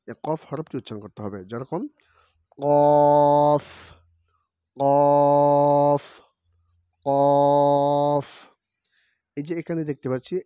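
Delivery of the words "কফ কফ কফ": কফ said loud and heavy from the tongue root; heavy back qaf. Shown as a demonstration. Teacher demonstrating the qaf to children. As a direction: bang out কফ hard from the back. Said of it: "জিহ্বার গোড়া তার বরাবর উপরে তালুর সঙ্গে".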